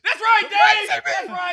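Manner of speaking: very high, loud mock cheer; mock heckler shout. Audience member heckling the comedian Dave, high mock shout. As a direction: yell it out like an over-eager fan.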